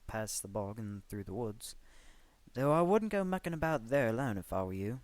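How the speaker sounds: lilting Welsh curator, ominous tease; Welsh curator warning. A narrator's reading. Curator warns of the island.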